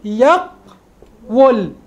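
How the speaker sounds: "يقول" very slowly as yaq... wul; underlying form. Shown as a demonstration. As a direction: hold a gap after the qaf in "يقول", then wul. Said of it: "وهي على هيئة".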